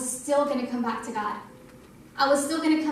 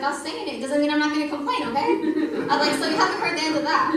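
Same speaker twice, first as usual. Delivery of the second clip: playful cheeky warning; 3 semitones higher, 4 dB louder, faster than usual. Retold or imitated. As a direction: tease God with a cheeky threat to keep complaining. Playful complaint to God.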